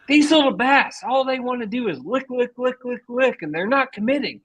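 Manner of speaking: put-on high-pitched, exasperated old-guy rant; mock old fisherman's complaint; imitated griping old angler. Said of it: "I just keep hearing him yelling".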